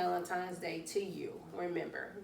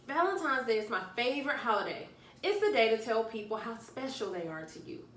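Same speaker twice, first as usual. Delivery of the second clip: read-aloud story voice; 2 semitones higher, 4 dB louder than usual. Acted. Reading picture book to children.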